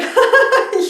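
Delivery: high, loud scoffing laugh; mocking laugh. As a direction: laugh off the very idea. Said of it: "she literally laughed at me".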